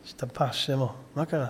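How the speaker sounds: quiet solemn murmur; hushed. Retold or imitated. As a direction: say it under your breath. Hushed exchange about death.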